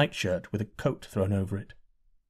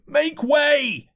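crowd shout. A narrator's reading. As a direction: bellow at the man in the way. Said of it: "cried the men all about him".

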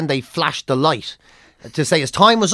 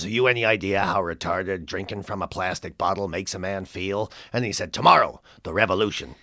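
much lower, gruff-edged Canadian comic voice, weary and done with everything; Mike Wilmot's Canadian accent; 11 semitones lower, 8 dB quieter than usual. Retold or imitated. A veteran Canadian comic sneering about a young act, in a low Canadian accent. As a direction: do the jaded old Canadian comedian. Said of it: "I'll do it in his accent".

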